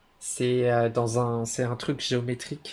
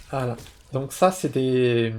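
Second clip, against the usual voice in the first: normal talking voice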